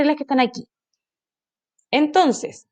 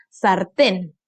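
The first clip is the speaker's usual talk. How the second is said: "sartén" said sar-TÉN; stress on last syllable; 4 semitones lower than usual. Shown as a demonstration. Teacher asks which syllable is tonic, "sartén" stressed on TÉN. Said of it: "Aquí se carga la voz".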